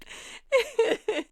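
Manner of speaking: high laughing burst; high burst of laughter. Real, not acted. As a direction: break into laughter. Laughing at Warren's line.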